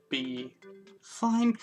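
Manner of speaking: ordinary talk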